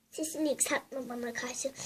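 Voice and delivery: hushed unvoiced comeback; soft whisper answering back; whispered retort. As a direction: hiss it back under your breath.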